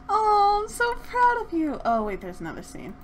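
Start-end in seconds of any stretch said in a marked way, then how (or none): 0.1-1.8 gushing proud. Acted. Voicing Sim's proud reaction.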